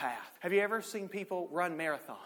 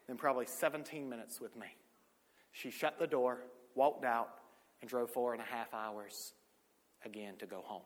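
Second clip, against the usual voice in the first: ordinary delivery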